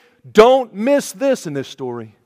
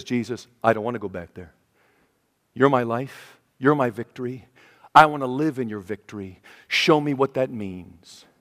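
quiet prayer; 8 semitones lower, 8 dB quieter than usual. Retold or imitated. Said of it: "and says".

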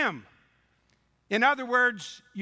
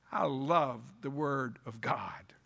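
whisper; 8 semitones lower, 5 dB quieter than usual. Real, not acted. Whispered aside in sermon.

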